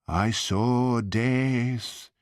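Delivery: slow, drawn-out mock moan. A narrator's reading. Fortune teller moans her vision to Hamish, slow and hammed-up. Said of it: "moaned Missus Bannerman".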